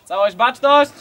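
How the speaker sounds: loud barked attention command; drill sergeant. Real, not acted. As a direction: call the whole troop to attention. Leader commands roll call.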